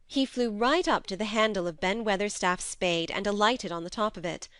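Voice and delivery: ordinary delivery, nothing marked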